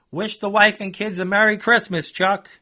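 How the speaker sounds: loud, high taunt; loud sarcastic send-off; mocking shout. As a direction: call after him with sarcastic cheer. Narrator taunts Chuck back.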